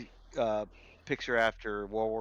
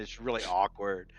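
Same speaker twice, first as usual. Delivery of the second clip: ordinary talk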